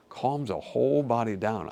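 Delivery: ordinary talk, nothing marked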